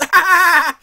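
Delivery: high loud burst of laughter; real laugh. Real, not acted. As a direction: laugh at your own slip. Laughs at own miscount.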